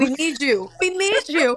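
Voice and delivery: high, loud, emphatic encouragement; playful reassurance. Real, not acted. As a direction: cheer on the family's odd one out, pitch climbing.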